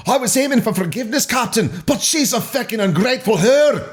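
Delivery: high, fast, hammed-up Scottish accent; exaggerated Scottish outburst to the captain; Scotty. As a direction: shout it like a panicked Scottish ship's engineer. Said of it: "suddenly want to beam up Scotty".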